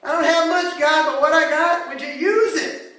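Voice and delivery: loud, high-pitched sermon climax; urgent shouted pleading to a congregation; impassioned preaching. Acted. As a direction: cry out the prayers at full force. Preaches at full force.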